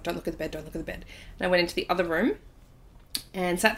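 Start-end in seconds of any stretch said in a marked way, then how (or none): 0.0-1.0 fast hushed repeating under breath; quiet rushed mantra. Retold or imitated. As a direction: rattle it off softly, quickly. Frightened self-talk walking out.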